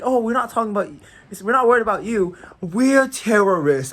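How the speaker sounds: loud, high, hammed-up retort; mock terrorist reply. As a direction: act out the strangers, loud and high. Acting out strangers' joking terrorist reply.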